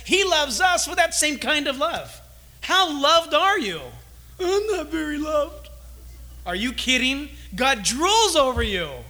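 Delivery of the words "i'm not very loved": mumbled self-pitying reply, lightly voiced; mopey doubter. Pastor voicing a doubter in the congregation, mild self-pity. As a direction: answer like a sulking listener who feels unloved.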